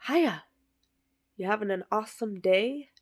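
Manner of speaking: sing-song hiya, rising at the end; chirpy cashier. Retold or imitated. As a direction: put on a cheery cashier voice. Cashier greeting quoted, high.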